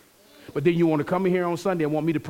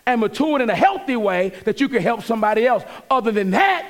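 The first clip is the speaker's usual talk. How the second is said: louder, higher preaching push; 6 semitones higher, 4 dB louder than usual. Acted. Pastor exhorting the church about correction, fervent preaching. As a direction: drive the point home from the pulpit.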